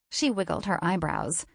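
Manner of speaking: ordinary delivery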